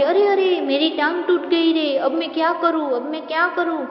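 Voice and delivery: high-pitched mock cry for help; old beggar hammed-up crying out after the fall. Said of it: "चिल्लाने लगता है".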